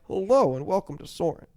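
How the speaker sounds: ride-host welcome with a swooping hello; projected pre-ride announcer impression; Putty. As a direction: play the chief flight attendant greeting guests. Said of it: "Putty, is now my".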